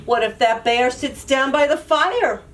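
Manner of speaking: usual speaking voice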